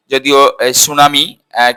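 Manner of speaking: ordinary talk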